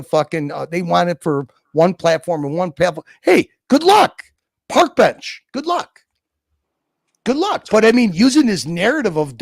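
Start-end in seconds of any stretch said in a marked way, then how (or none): 3.2-7.7 mocking good luck. Real, not acted. Taunting rival platform.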